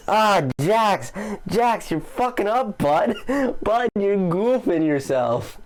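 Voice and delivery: high, playful mock-scolding of a buddy; teasing scold. Commentator ribbing the Jack's intern: high mock scold. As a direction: rib him for blowing it.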